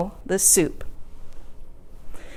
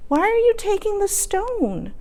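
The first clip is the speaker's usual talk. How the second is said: much higher, puzzled question; old lady voice; 11 semitones higher, 4 dB quieter than usual. Acted. Old lady asking the young man, high and puzzled. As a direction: ask in surprise.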